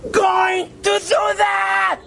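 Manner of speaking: high slow furious yell; shouting. Retold or imitated. Storyteller reenacts shouting at the woman, a high furious yell. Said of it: "I was shouting".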